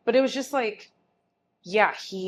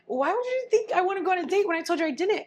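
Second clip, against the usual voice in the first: exasperated retort; 11 semitones higher, faster than usual. Retold or imitated. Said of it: "But I was like".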